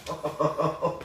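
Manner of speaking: soft, real laugh; amused laugh. Real, not acted. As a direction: laugh quietly at the dog's antics. Amused by the dog's antics.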